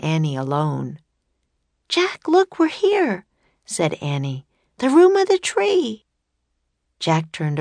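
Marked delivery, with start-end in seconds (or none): 1.9-3.2 put-on high-pitched, thrilled Annie-style child voice; excited, much higher little-girl voice. A narrator's reading. Annie excited at finding the room. 4.8-6.0 put-on high, louder girl's exclamation; high-pitched excited child. A narrator's reading. Annie excited at the discovery.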